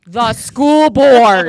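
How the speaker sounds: drawn out, loud and deliberate; slow emphatic punchline. Retold or imitated. Telling the voter flatly who hires teachers, slow and loud. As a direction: land the punchline slowly and loudly.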